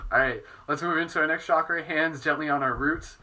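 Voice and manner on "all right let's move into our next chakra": ordinary talk, nothing marked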